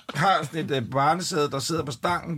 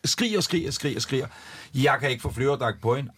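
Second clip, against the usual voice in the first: ordinary talk, nothing marked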